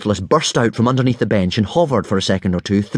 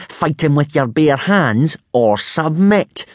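slow, higher-pitched sneer; 6 semitones higher, slower than usual. A narrator's reading. Rival mocks Hiccup for losing his sword, sneering. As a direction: draw out the taunt scornfully. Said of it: "sneered Snotlout".

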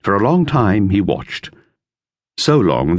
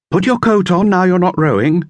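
higher, louder call over water; a child calling from a boat; 12 semitones higher, 3 dB louder than usual. A narrator's reading. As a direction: call it across the lake.

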